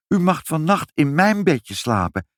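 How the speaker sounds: put-on high-pitched, eager little child; child's voice. A narrator's reading. Child offers the old man her bed, high and earnest. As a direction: pity him, then invite him in, high and childlike. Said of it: "zei Ibbeltje".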